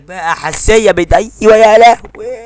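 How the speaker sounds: excited outburst. Real, not acted. Player reacting to a chance, excited loud cry. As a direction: let it burst out of you.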